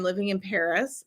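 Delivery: ordinary talk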